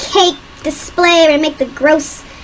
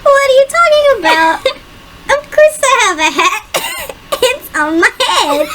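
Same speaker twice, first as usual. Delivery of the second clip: laughing whiny protest; 9 semitones higher than usual. Retold or imitated. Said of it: "I'm like".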